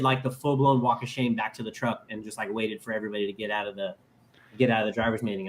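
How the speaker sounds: ordinary delivery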